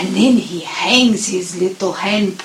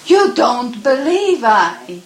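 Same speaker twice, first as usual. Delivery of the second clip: hurt little cub; 6 semitones higher, 6 dB louder than usual. Acted.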